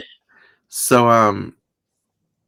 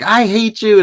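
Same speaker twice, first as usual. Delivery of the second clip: high, loud outburst of mock hatred; a hammed-up crazy lady; unhinged woman; 13 semitones higher, 8 dB louder, slower than usual. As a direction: play the eccentric woman snapping at her boyfriend. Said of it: "the woman is very e-, e-, eccentric".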